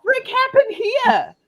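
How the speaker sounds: very high, slow, loud incredulous cry; hammed-up high shock; shocked disbelief. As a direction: squeal it as if aghast.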